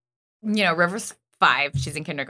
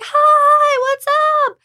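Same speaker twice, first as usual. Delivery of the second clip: put-on a child's voice, far higher and louder; excited kid; 8 dB louder, much slower than usual. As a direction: squeal it like a five-year-old thrilled to see mom. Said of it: "And she's like".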